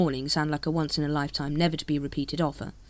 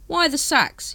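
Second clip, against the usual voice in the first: higher, louder question; curious captain; 10 semitones higher, 5 dB louder, slower than usual. Acted. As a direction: ask it sharply. Vimes asks.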